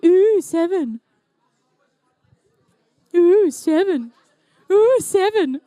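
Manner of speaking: Ooh, seven drawn out with a laugh; mock James Bond. Commentator joking that Bond would be Ooh seven, laughing. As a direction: coo Ooh, seven teasingly. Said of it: "he'd be".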